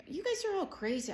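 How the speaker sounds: high-pitched mock disbelief; exasperated disbelief. Mom dismissing her kids' complaint, exasperated. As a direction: scoff it, swooping up high.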